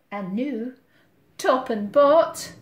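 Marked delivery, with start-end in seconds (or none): none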